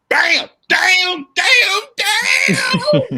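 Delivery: high, loud hammed-up sobbing shout; mock wailing cry. Mother grieving her husband, hammed-up wailing sob. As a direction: wail it like Florida breaking down, hammed up. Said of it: "She said".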